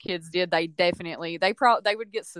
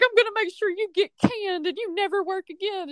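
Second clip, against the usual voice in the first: high, hammed-up tirade; mock outraged threat; 10 semitones higher than usual. Mean girl threatens teacher's job, mock outrage. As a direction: imitate a furious student, pitched way up.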